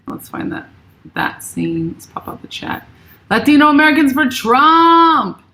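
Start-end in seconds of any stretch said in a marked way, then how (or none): 3.3-5.4 high, loud cheer for "Trump"; excited shout. Real, not acted. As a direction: belt it out like a rally cry. Rereading the same chat message loudly.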